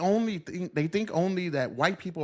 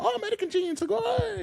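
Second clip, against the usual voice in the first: much higher, sing-song imitation; high, hammed-up foreign voice; mock Japanese reaction. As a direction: parody an impressed local, pitched way up.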